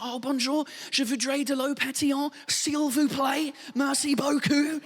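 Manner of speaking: hammed-up English accent in French, drawn-out polite words; British-accented French. British customer politely ordering sparkling water in French, hammed-up English accent. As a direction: put on a thick British accent, stretching the courtesies. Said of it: "Si moi je suis là".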